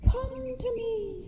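very high, soft, slow echo. Acted. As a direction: float it back like a faint echo. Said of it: "and she echoed".

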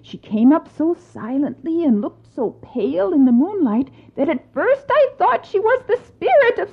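high, excited servant gossip; startled servant. Acted.

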